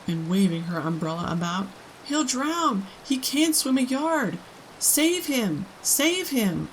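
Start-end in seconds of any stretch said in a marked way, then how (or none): 2.1-6.7 high frantic cries; panicked mother. A narrator's reading. Mother begs for help as her son drowns, frantic. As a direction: shriek in terror for her son.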